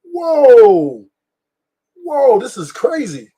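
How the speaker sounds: high, loud drawn-out whoa of disbelief; retelling a client's story, stunned whoa; shocked whoa. Retold or imitated. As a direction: react like you can't believe your ears. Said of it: "I was like".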